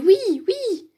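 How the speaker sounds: put-on a class of kids shouting yes; children shouting. A narrator's reading. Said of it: "Tout le monde a crié".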